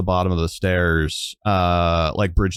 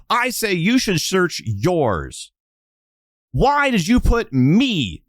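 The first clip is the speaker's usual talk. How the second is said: tearful yelling; 13 semitones higher, slower than usual. Acted. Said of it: "crying as she's like yelling in Bridget's face".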